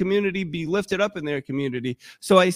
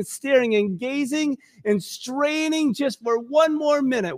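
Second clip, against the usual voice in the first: high, straining plea that slows at the end; impassioned preaching; 7 semitones higher than usual. Acted. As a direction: build it into a longing cry. Preaching at full force, rising.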